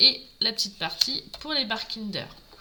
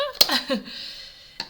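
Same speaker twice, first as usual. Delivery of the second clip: little unvoiced chuckle; a breathy laugh at her own joke; 4 semitones higher than usual. Real, not acted. Laughs about avoiding catastrophes. (petit rire soufflé)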